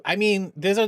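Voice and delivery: ordinary talk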